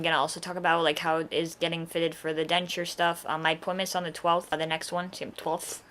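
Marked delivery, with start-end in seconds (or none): 5.1-5.8 lisping through numb gums; slushy lisp after tooth removal; Sylvester-like lisp. Real, not acted. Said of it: "talking like Sylvester from Looney Tunes".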